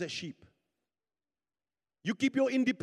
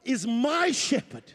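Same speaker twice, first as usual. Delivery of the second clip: raised, emphatic preacher's declaration; forceful preaching; 3 semitones higher, 5 dB louder than usual. Real, not acted. Preacher declaring God's favor upon the congregation, forceful preaching. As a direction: proclaim each blessing over the congregation.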